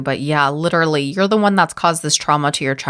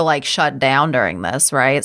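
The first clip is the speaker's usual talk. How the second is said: usual speaking voice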